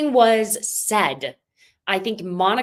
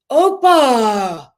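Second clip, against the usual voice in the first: loud, higher-pitched retold shout; mock yell; 5 semitones higher, 10 dB louder than usual. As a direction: yell it out in imitation, stretched. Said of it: "throws her glass and yells".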